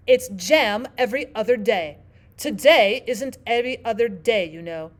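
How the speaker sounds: loud, emphatic Queen; imperious Queen. Acted. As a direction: explain with royal authority.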